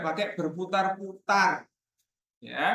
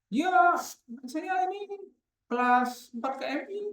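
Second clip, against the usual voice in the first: chatty parent; 13 semitones higher than usual. Retold or imitated. Teacher acts out parents chatting at school.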